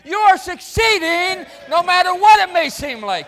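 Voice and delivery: very loud, high, shouted preaching; full-force preaching. Acted. Preacher proclaiming the resurrection, shouting at full force. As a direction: climax of the sermon, preach it out.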